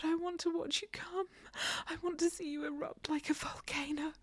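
usual speaking voice

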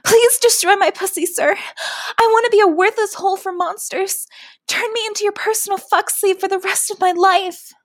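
high-pitched desperate yell; loud start, sustained high plea. Acted. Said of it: "I yelled at him".

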